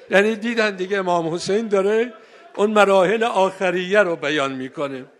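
ordinary talk

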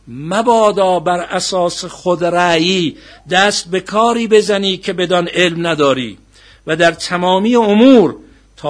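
loud, slow declaimed precept, easing at the end; forceful preaching recitation. Acted. Preacher urging caution in all affairs, forceful recitation. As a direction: declaim it weightily, stretching the closing words.

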